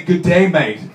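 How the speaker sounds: loud mock Australian greeting; Australian accent. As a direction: put on a hearty Australian accent. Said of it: "Australian accent, through and through".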